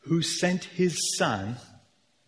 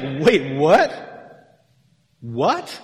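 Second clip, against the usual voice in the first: mock disbelief; 5 dB louder, much slower than usual. Imagined readers hearing shocking claim.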